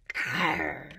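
low pretend growl; playful bear growl. Imitates the bear for children.

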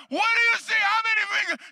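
high, loud, urgent questioning; re-enacted excited shout. Preacher testing the blind man's sight, excited shout. Second test from back of platform.

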